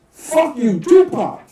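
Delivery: high, loud shouted insult; taunting yell. Retold or imitated. Kid taunts [name] by calling him [name], a loud high yell. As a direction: hurl it and bolt.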